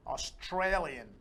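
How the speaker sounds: normal talking voice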